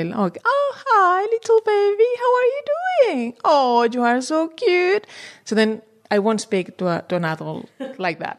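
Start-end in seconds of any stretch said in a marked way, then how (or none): 0.4-5.1 put-on much higher, sing-song coo to a baby, slowing at the end; baby talk. Shown as a demonstration. Researcher demonstrating infant-directed speech, high cooing baby talk. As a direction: coo at an infant like a delighted parent. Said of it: "I feel silly actually speaking like".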